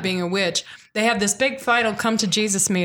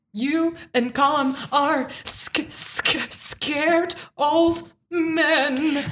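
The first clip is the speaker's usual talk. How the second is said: very slow, stammered accusation; halting mock defiance; 3 semitones higher, much slower than usual. Son stands up to his dad, halting mock defiance. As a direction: stumble on the word, then stretch it out.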